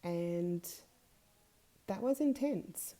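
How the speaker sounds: ordinary talk, nothing marked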